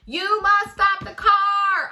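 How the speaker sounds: very loud, high, slow, almost sung; shouting. Acted. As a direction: yell to the driver from the police car. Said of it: "Officer Tomaleo shouted".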